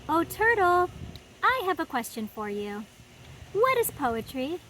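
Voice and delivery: put-on lively read-aloud for little kids; storybook reading. A narrator's reading. Host reading a picture book to children, animated storytime voice. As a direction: read it like story time in the garden.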